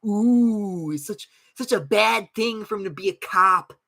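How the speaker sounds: mock fussy voice of the critics, high and loud. Streamer mocking critics of a cop character, high mock whine. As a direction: parody the people he disagrees with. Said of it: "because, like".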